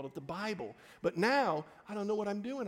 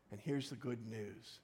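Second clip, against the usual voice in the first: ordinary talk, nothing marked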